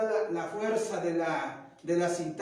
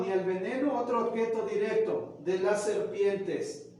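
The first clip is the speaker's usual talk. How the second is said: normal talking voice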